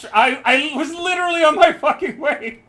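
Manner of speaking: loud high protest; indignant shout. Real, not acted. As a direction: yell it in outrage.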